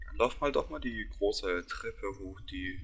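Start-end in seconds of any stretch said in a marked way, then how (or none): none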